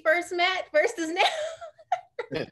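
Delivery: high, loud and dissolving into laughs; drawn-out words giving way to real laughter; breaking into laughter. Real, not acted. Joking about her former self.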